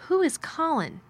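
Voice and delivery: high-pitched, uncertain question; faltering. A narrator's reading. Girl questioning the strange boy, faltering. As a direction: falter slightly, voice high then falling. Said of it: "she faltered".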